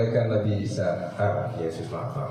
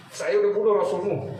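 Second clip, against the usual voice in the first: mocking taunt; 9 semitones higher than usual. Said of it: "Kacau ya".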